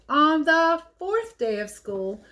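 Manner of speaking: ordinary delivery, nothing marked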